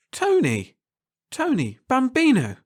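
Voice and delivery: calling out a name, high and eager; joyful shout of recognition; excited calling. A narrator's reading. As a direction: greet an old friend with delight. Calling out to Tony.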